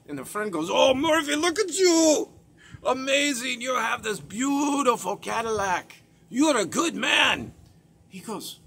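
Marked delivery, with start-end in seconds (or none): 0.7-7.5 high, loud gushing praise; excited admiration. Retold or imitated. Friend in a Hyundai praising Murphy's Cadillac, gushing. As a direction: rave over the shiny car.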